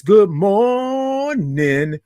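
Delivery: long sung-out "good morning" drawn high and slow; drawn-out morning show greeting; stretched good morning. Shown as a demonstration. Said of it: "I love all those O's and R's and I N G's".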